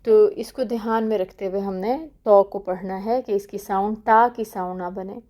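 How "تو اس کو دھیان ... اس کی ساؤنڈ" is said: ordinary talk, nothing marked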